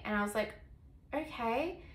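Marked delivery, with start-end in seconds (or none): 1.1-1.8 mildly unsure, higher rising okay; retold reluctant yes, lifting into a question; hesitant agreement. Hesitant reply to role play request.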